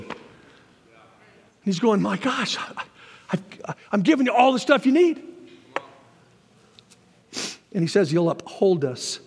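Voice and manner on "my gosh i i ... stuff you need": lightly acted, stammering bafflement; mild exasperation. God answering complaining believers, mildly exasperated. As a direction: protest as if baffled they missed it.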